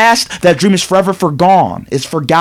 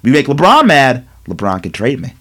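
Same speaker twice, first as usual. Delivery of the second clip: put-on defiant young player; 8 semitones lower than usual. Lonzo taunting his coach about trades, low defiant mock voice. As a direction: shrug off the coach, low and careless. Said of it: "Lonzo's like".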